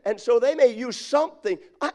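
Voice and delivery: normal talking voice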